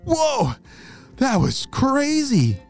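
high shocked exclaim; amazed whoa. Acted. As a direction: react to the blast. After the crash.

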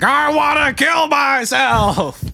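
high, drawn-out mock shout; Adam Sandler, fed up. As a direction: wail it slowly as a fed-up husband. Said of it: "fed up husband".

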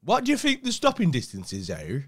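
loud, high, keen course-leader voice; mock enthusiastic instructor. Mimicking a driving course instructor, mock enthusiasm. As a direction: imitate an over-eager instructor pitching up. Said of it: "to muster that much enthusiasm for something".

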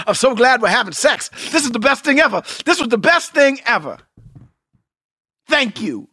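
hammed-up joyful gushing, half-laughing at himself; mock-ecstatic husband. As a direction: gush like it's the best night of your life. Acting himself thrilled mid-sex.

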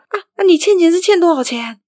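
high, flustered urgent question; imitated panic. Mum asking how much is owed, mock panic. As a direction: act out an alarmed mum, pitch jumping up. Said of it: "然后我妈就很惊慌".

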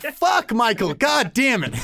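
high, comic cursing outburst; mock exasperation. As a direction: curse yourself in hammed-up frustration. Acted self-cursing after giving in.

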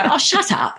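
high-pitched mock exasperation; exasperated retort. Runner snapping back at her inner voice, mock exasperated. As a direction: brush off the nagging voice, half laughing. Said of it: "and then I'd go".

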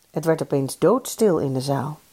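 plain reading voice

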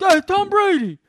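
hammed-up chant of the hero's name; obnoxious Boston bro.

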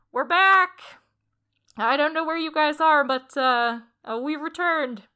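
loud call through cupped hands. Acted. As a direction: call out down the hallway. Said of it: "cups his hands to his mouth".

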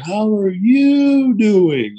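very slow, loud, high mock greeting; condescendingly slow. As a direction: drag out each word as if he can't understand. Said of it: "talking slow 'cause you can't talk faster".

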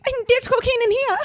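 squeaky little dog voice, very high; a tiny dog talking in a high squeak. Pretend. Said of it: "being like".

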